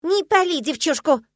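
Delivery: high, loud, drawn-out squirrel squeal; squirrel squeak. A narrator's reading. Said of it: "раздался знакомый беличий писк". (высокий, громкий беличий писк)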